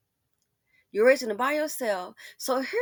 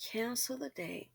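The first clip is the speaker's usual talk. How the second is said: whisper; 2 semitones higher, 13 dB quieter than usual. Retold or imitated. Whispered echo of the cancellation.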